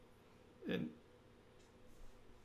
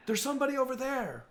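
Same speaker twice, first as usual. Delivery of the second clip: alarmed villager; 10 semitones higher than usual. Acted. Villager points at trees.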